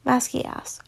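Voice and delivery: plain reading voice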